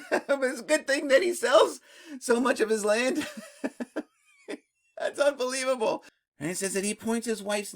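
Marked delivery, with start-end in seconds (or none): 0.1-6.0 laughing through the words, higher pitch; amused disbelief. Real, not acted. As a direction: marvel at it while laughing. Laughs at an ironic historical twist.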